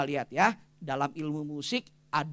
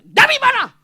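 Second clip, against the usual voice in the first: high, loud mock yell; husband yelling; 15 dB louder than usual. Husband yelling at wife coming home late, hammed-up shout. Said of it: "Suami teriak-teriak".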